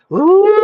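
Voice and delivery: high, sustained siren imitation; long high "ooh", swelling like a siren; siren wail. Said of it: "that's the sound that they made".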